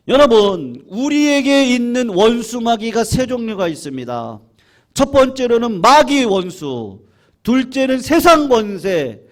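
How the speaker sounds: high, loud, stretched-out sermon cry; fiery pulpit delivery; full-force preaching. Acted. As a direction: preach it to the back row.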